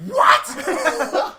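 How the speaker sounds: mock surprise. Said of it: "I was like".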